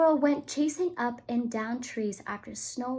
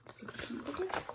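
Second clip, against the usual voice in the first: plain reading voice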